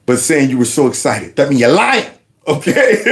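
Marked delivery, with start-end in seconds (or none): none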